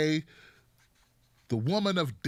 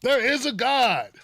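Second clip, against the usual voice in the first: high and slow, savoring the triumph; slower triumphant cry; 6 semitones higher, 4 dB louder, slower than usual. Pretend. Comedian repeating his gloat, slow and high. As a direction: draw out the gloat.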